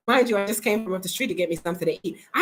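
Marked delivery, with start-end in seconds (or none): none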